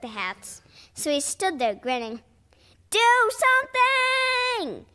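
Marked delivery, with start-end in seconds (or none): 2.9-4.8 slow, high, loud desperate howl; desperate captain howling. Acted. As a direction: wail it out. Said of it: "Captain Blackbeard howled".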